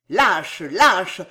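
loud, scornful shout; yelled insult. A narrator's reading. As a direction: hurl it at a hidden enemy. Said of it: "hurla la voix de Danville".

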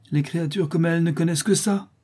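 high-pitched, scornful reply; contemptuous retort. A narrator's reading. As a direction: dismiss her kind with disdain. Widow answers with contempt. (réplique méprisante)

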